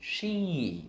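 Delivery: "sheep" starting high and gliding down. Shown as a demonstration. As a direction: stretch "sheep" with a falling swoop. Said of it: "lên giọng xuống giọng một chút".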